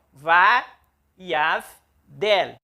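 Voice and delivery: vá, yav, del each said separately; syllables apart. Shown as a demonstration. Teacher repeating syllables va, yav, del. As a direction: chop the word into vá, yav, del. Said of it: "Então".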